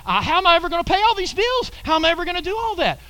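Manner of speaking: high, fast fretting over the bills; mock worrying. Believer fretting over unpaid bills, high and hurried. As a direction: rattle it off like a frantic worrier. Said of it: "Instead of troubling about it".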